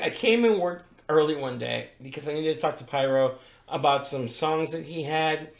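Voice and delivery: ordinary delivery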